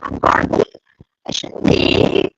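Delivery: usual speaking voice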